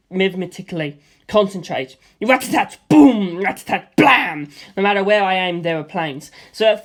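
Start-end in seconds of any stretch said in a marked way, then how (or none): 2.2-4.5 loud rat-a-tat and boom; mouthed gunfire and blast; gunfire noises. A narrator's reading. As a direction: bang out the shots.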